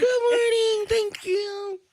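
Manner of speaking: big high sung-out good morning; high showy greeting. Acted. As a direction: belt the greeting up high like a warmed-up singer. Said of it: "Y'all know I had to warm up my voice".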